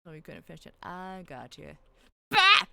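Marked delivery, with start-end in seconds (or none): 2.3-2.6 sudden loud startled cry; startled yelp. Real, not acted. Streamer yelping at a sudden computer noise. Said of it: "My computer screamed at me".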